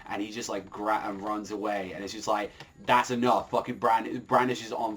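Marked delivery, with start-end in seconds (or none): none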